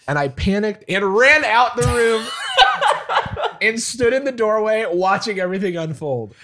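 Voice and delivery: laughing through the story; giggling as she tells it; laughing while talking. Real, not acted. Laughing while telling the panic.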